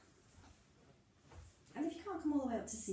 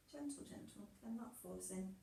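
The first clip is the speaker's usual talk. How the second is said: gentle; 2 semitones lower, 9 dB quieter than usual. Shown as a demonstration.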